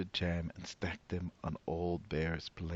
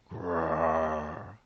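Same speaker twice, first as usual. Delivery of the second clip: bear roar. Acted. Bear roaring as the woman tugs his fur. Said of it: "Old Bear roared".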